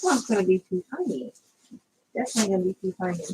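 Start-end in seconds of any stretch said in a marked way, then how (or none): 2.1-3.2 rattled off fast under the breath; tossing off the verdict quickly; rushed repeat. Real, not acted. Crafter dismissing a too-tiny palm maker, fast.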